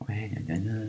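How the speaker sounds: mock the insulters' babble under the breath; mocking gna gna gna. Mocking the insulters who attack him, sneering babble.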